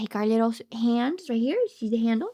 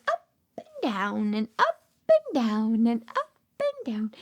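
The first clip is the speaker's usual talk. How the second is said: seesaw voice; 7 semitones lower than usual. Shown as a demonstration. Showing little kids the seesaw going, swooping sing-song. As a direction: swing the pitch like a seesaw.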